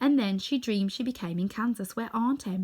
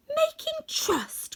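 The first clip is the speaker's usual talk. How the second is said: very high, drawn-out disbelief; crying out; much slower than usual. A narrator's reading. Dorothy challenges the little man, high astonished cry. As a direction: squeal the question slowly. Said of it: "cried Dorothy".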